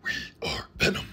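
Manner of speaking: low, unvoiced monster voice; deep creature rasp; Venom. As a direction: growl it low like an alien symbiote. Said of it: "both Eddie Brock and Venom".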